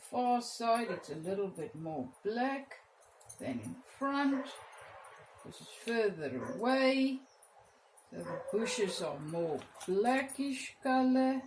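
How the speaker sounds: usual speaking voice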